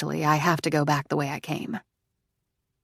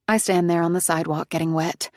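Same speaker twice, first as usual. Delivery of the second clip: ordinary delivery, nothing marked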